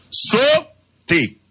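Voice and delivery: "Sauter" sung out high, both syllables separated; mot détaché. Shown as a demonstration. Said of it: "Deux syllabes". ("Sauter" haut, syllabes détachées)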